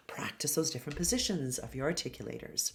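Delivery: quiet whispered instruction. Shown as a demonstration. Coach whispers practice advice to the class. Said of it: "lesson instruction whispered".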